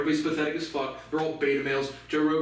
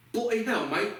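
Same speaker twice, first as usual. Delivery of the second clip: mock Australian; 6 semitones higher than usual. Mock Aussie slip.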